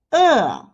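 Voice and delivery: "uh" falling from high. Shown as a demonstration. Teacher leads repeat drill, falling uh. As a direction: drop "uh" from the top. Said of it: "The fourth tone is the falling tone".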